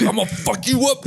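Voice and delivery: high, sing-song mock threat. Imitating a pinned drunk guy, high mock menace. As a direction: mimic a pinned guy's empty threat, high and lilting.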